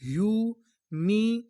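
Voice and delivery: Humiliation stretched out slowly; slow blending. Shown as a demonstration. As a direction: sound out humiliation for the class. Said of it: "तो पूरी meaning क्या बनी?".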